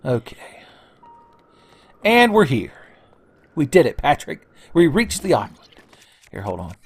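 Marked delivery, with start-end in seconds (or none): 2.0-5.6 higher, louder celebratory announcement; mock triumph. Player celebrating to Patrick on reaching the island, triumphant. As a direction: play up a triumphant arrival.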